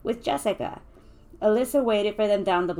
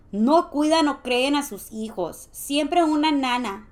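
higher, louder scolding in Spanish; disapproving Spanish-speaking woman; 6 semitones higher, 6 dB louder than usual. A narrator's reading. Juanita griping about rich folks, raised scolding voice. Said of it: "she would always say".

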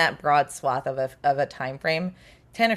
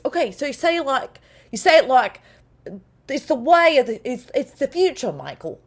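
higher, louder, exaggerated line reading of "it's the future, Michael"; mock line reading; 8 semitones higher, 4 dB louder than usual. Kiwi director giving the voice actor a line reading, mock exaggerated. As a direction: director hammering out how to say the line. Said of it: "he just gave him a line reading".

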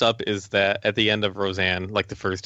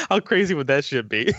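laughing; 9 semitones higher than usual. Real, not acted. Laughing at own idea.

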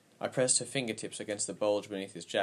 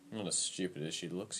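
very soft, faint croak of a squeak; quiet, grudging invalid's aside; 3 semitones lower, 8 dB quieter than usual. A narrator's reading. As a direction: squeak it feebly, barely voiced. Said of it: "he squeaked".

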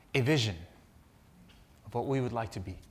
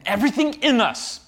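higher, louder rising preach; preaching at full force; 11 semitones higher, 10 dB louder than usual. Acted. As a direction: build it to a passionate peak. Preacher builds to climax.

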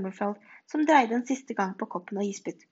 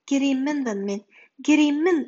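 louder, crying out the omen; dramatic exclamation; 4 semitones higher, 6 dB louder than usual. A narrator's reading. Professor announcing the dreaded omen to her pupil, loud exclamation. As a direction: proclaim it with horror, louder. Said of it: "utbrøt professor Rummelfjord".

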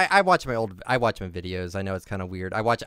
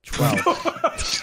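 laughing; 12 semitones higher than usual. Real, not acted. Laughing while saying his problem word.

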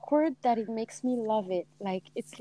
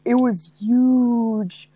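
slow, louder mock politician, stretched HUGE; Trump; 5 dB louder, much slower than usual. Imitating a president for a friend, slow and louder. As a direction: boast with a long, drawn-out huge.

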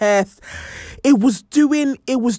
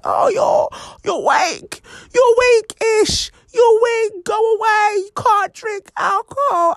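mocking boys. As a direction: jeer at her like a teasing big boy. Boys mocking her as weak.

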